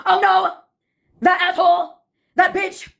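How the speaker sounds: put-on high-pitched theater-kid outburst; theatrical scream. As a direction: play it like a melodramatic musical chorus. Recurring theater-kid chorus.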